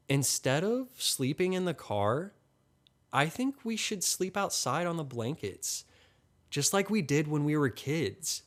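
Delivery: put-on high, eager girl's voice without extra volume; excited. A narrator's reading. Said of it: "Natalie says excitedly".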